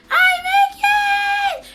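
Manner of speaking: imitating a shrieking, very high, very loud, drawn-out cry; excited scream. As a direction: mock-scream it high and slow like a thrilled girl on the phone. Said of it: "they start screaming like".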